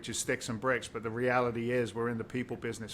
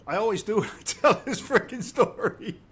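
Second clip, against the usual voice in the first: getting emotional; 9 semitones higher, 4 dB louder than usual. Real, not acted. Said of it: "I'm gonna get emotional".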